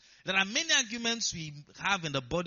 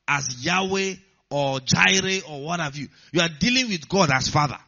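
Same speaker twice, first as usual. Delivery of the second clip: louder, rising preacher's proclamation; building sermon declaration, louder; full-force preaching; 6 dB louder than usual. Acted. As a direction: preach it out to the congregation.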